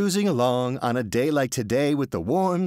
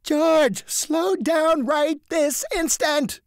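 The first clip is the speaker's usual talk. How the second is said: high, loud, slow command; stern demand; 4 dB louder, much slower than usual. A narrator's reading. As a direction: order him to stop, word by word. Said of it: "he demands".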